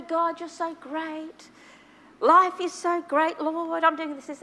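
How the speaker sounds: slow, high, drawn-out gushing to God; smug happy praise. Retold or imitated. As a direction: overflow with self-satisfied joy. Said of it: "I'm just going along and".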